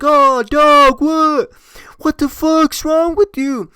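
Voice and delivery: high, loud imitation of a horrified friend; mock outraged yell. Friend in the back reacting to pee flying, mock outraged yell.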